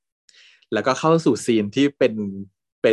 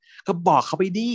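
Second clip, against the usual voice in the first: goading dare; 9 semitones higher, slower than usual. Retold or imitated. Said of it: "พัดก็บอกว่า".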